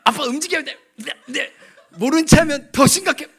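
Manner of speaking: laughing mock child plea; imitating daughter. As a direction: mimic the daughter urging dad. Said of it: "저한테".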